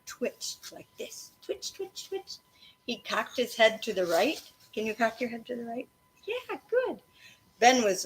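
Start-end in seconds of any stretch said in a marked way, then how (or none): 1.4-2.3 high whispered twitch, twitch; whispered twitch sounds. Shown as a demonstration. Teacher acts out ear twitch. 6.3-7.0 encouraging praise. Real, not acted. Teacher praising children.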